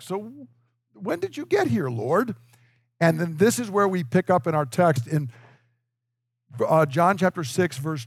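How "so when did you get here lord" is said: much higher, sheepish innocent question; guilty child changing the subject; caught kid. Retold or imitated. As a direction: play a kid caught out, asking sweetly. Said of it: "little kids when you catch them".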